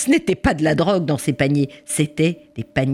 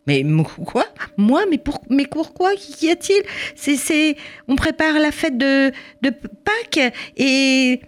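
much higher, stammering disbelief; protesting mother; 10 semitones higher than usual. Acted. Mother protesting to police about her arrest, higher stammering. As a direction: sputter in outraged disbelief.

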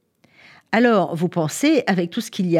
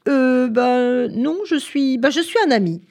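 drawn-out, higher-pitched stammering start; hesitant, taken aback; 7 semitones higher, 4 dB louder than usual. A narrator's reading. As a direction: stall on the first words, then find the answer. Gorilla caught out by odd question.

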